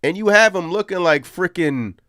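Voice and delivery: loud, high, incredulous rant. Real, not acted. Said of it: "that was my little rant".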